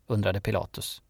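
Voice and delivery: ordinary delivery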